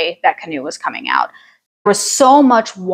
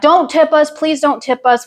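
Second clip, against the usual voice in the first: mild mock yell; 6 semitones higher than usual. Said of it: "And I start yelling".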